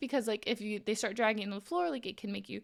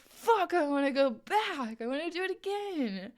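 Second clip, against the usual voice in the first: excited; 5 semitones higher than usual. Retold or imitated. Hooked after the lesson.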